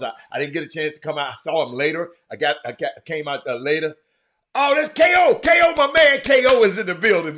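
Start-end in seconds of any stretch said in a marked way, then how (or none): 4.5-7.4 loud and high greeting; excited shout-out. Real, not acted. Streamer loudly greeting K O arriving in chat. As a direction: hype your friend up as he arrives.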